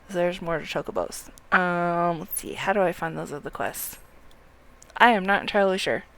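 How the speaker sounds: ordinary talk, nothing marked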